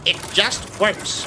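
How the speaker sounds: high, slow salesman reassurance; slow, high mock pitch of the famous line; Todd Howard. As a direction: play a smug game boss selling a buggy product. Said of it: "He would look at it and say".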